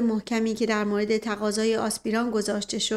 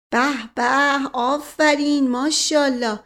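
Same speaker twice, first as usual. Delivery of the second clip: lightly acted, louder admiring exclamations; exaggerated approval; 4 semitones higher, 5 dB louder, slower than usual. A narrator's reading. Asadollah cheers the news about the sister, mildly gushing approval. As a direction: applaud it with relish.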